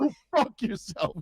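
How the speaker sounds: high cartoon insult; angry old man. Old man ending standoff with Cartman, mock anger. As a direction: dismiss them in mock outrage. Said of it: "the old man".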